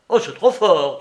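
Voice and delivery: put-on loud, outraged old man's cry; indignant cry. Old Soupe cries out at Larrier, outraged. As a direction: cry out that it's too much. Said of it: "cria le père Soupe".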